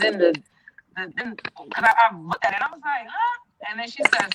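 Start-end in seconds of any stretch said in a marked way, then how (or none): 3.1-3.4 high, puzzled huh; a sharp confused huh at the phone; incredulous huh; baffled huh. Retold or imitated. Reacting to daughter's text.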